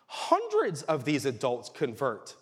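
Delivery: usual speaking voice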